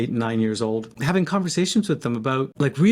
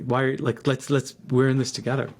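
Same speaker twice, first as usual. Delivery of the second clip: ordinary talk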